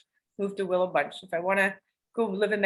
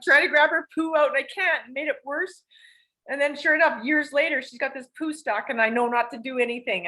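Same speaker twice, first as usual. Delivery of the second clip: ordinary delivery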